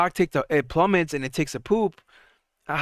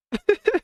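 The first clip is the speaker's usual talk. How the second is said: short laugh; 5 dB louder than usual. Real, not acted. Sudden laugh at the market.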